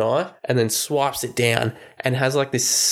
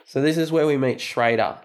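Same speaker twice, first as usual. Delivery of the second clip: normal talking voice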